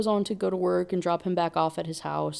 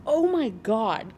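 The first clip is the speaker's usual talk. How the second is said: slow, high, louder "oh my God"; appalled exclamation; 5 semitones higher, 5 dB louder, slower than usual. Real, not acted. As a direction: react aghast, drawing it out. Appalled reaction to the story.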